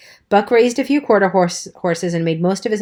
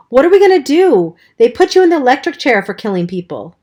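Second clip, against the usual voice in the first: high, loud panicked pleading; 6 semitones higher, 5 dB louder than usual. Acted. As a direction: voice climbing in panic. Panicked boy fears electric chair.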